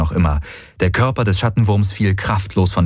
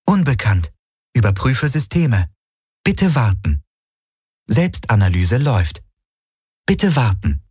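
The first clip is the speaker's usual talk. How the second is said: ship computer; 3 semitones higher than usual. A narrator's reading. Ship answers Kirai's question, computer announcement. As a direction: report in separate units with falling endings.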